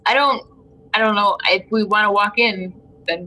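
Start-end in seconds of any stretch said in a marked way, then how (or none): none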